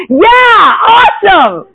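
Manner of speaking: high, loud gushing cheer; over-the-top fake enthusiasm; mock cheering. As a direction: whoop it like huge good news. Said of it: "You're not like".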